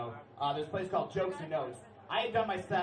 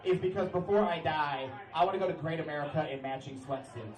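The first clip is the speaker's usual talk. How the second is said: usual speaking voice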